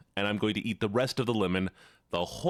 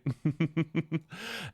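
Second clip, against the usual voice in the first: a short breathy laugh that falls away; self-conscious laugh; 5 semitones higher than usual. Real, not acted. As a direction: laugh it off at yourself. Laughs at own self-mockery.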